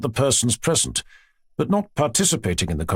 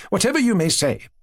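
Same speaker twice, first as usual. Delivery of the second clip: a touch higher, eager society lady; a hint of a gushing princess; lightly voiced chatty princess; 9 semitones higher than usual. Princess telling her aunt about her children, lightly gushing.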